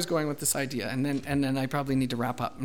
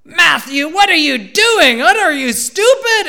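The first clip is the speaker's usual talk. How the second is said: high, very loud furious scolding; angry yell; 13 semitones higher, 13 dB louder than usual. Retold or imitated. Father yelling at his son over spilled juice, angry yell. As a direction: re-enact a parent losing his temper, yelling. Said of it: "and I yelled at him".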